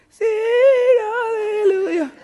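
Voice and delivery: high falsetto sung hallelujah; lady voice. Retold or imitated. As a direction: sing it like the church ladies, up high. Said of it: "that was my lady voice".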